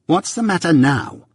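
high-pitched, sharp repeated question; sharp demand. A narrator's reading. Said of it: "repeated my sister more sharply".

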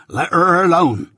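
insist, pitching it up; firm protest. Acted. Husband stepping in for his wife, firm protest. Joe intervenes in the quarrel.

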